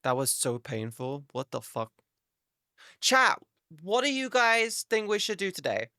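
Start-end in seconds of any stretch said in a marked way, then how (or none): 3.0-5.9 much higher bright hello; upbeat streamer call. Real, not acted. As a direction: perk up and address the audience.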